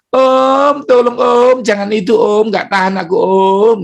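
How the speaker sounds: high begging voice, easing down; the hurting stomach begs, then wilts; pleading stomach. Pretend. Aching stomach begs its owner for mercy, pleading. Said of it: "dia mau ngomong sama tuannya".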